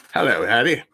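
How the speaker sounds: loud mimicked catchphrase greeting; Tom Baker. Fan showing he can do it, loud mimicked greeting. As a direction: put on the actor's booming hello. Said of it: "I can do that".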